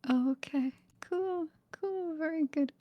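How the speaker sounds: ordinary talk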